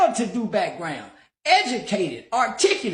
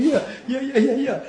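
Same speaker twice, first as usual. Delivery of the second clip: ordinary talk, nothing marked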